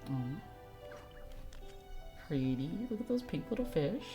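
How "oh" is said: usual speaking voice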